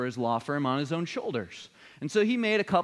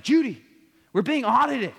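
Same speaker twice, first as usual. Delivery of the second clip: loud, much higher panicked call; 9 semitones higher, 5 dB louder than usual. Retold or imitated. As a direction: yell it to the next room. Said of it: "he called out to my mom in the other room".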